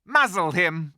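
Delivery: the witch commands the muzzle. A narrator's reading. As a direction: bark the order. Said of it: "said the witch".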